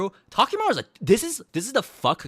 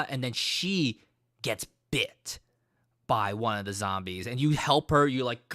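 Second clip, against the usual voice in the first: ordinary talk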